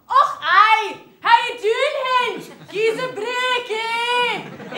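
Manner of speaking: loud, high, hammed-up Scottish accent; mock Scottish accent. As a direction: bellow a bad caricature of Scottish. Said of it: "try to do a Scottish accent".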